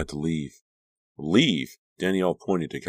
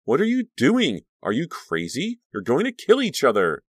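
panicked shouting; 9 semitones higher, 4 dB louder than usual. Acted. Friend screaming at fight.

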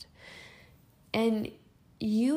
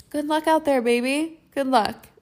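higher, louder sassy send-off; 4 semitones higher, 6 dB louder than usual. Acted. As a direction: tease them with a cheeky good luck. Sassy send-off to people-pleasers.

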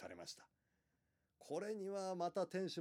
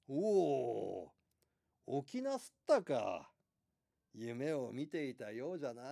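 put-on louder, slow old man's greeting; creaky wise old man; 6 dB louder, slower than usual. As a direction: speak as a kindly old innkeeper. Old innkeeper greets waking man.

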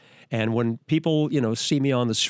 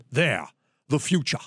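Hitchcock impression. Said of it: "he was talking like this".

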